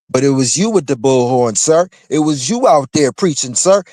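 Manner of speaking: rising indignant confrontation; heated callout. Real, not acted. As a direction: call him out hard.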